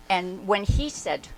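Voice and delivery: ordinary talk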